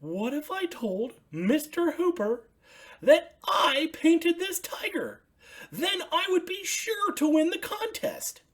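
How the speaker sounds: high, bright puppet voice for Ernie; excited high Ernie calling out. Acted. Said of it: "said Ernie".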